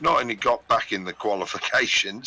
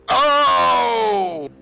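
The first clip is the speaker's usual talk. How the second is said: loud high shocked cry; surprised shout; 13 semitones higher, 9 dB louder than usual. Real, not acted. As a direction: yell out in shock at the roll. Reacting to dice roll.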